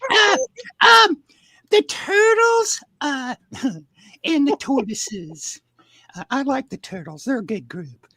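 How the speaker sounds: very high, halting falsetto; squeaky put-on voice stumbling through lines; Becky impression. [name] reads turtle lines as Becky, halting falsetto. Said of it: "we got [name] with the Becky accent".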